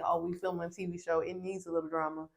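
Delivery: soft, fast throwaway; imagined housemates' thought. Retold or imitated. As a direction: mutter it as their thought. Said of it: "they probably thinking".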